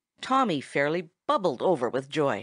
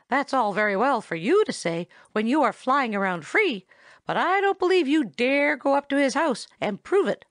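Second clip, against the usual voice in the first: higher, challenging squirrel voice; retorting; 8 semitones higher, 4 dB louder than usual. A narrator's reading. Chatterer challenges Tommy to visit the house, retorting. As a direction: dare him to prove it. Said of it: "retorted Chatterer".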